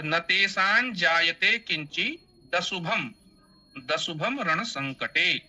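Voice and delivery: slow measured chant, final t of किंचि carried into दशुभम; joined metrical recitation. Shown as a demonstration. Teacher showing how to recite as written, joined metrical reading. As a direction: recite slowly with short किंचि, linking into दशुभम. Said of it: "इस तरीके से हमें पाठ करना चाहिए".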